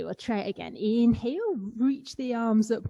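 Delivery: usual speaking voice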